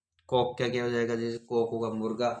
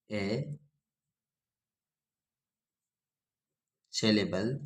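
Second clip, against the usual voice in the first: one word at a time, long pauses between; slow dictation so the students can write it down; dictating; much slower than usual. Shown as a demonstration. Teacher dictating a heading to the class, word by word. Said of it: "लिखो".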